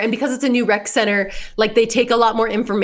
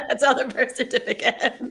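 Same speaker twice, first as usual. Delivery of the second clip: laughing; 3 semitones higher, 5 dB quieter than usual. Real, not acted. Echoing the joke while laughing.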